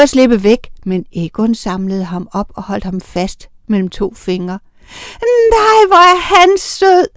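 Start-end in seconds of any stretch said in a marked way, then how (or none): 5.5-7.1 much higher, louder and slow, delighted cooing; gushing exclamation. A narrator's reading. Carla gushing over the tiny vampire, delighted. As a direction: coo over him like a cute pet. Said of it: "udbrød Carla".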